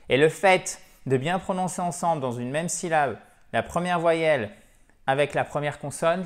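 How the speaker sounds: ordinary talk, nothing marked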